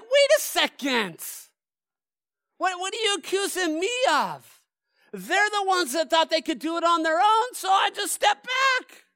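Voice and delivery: high, loud, offended and defensive; God answering back. Retold or imitated. God defending himself to his accusers, an offended protest. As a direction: play God pushing back on the blame. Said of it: "And if I'm God, I'm like".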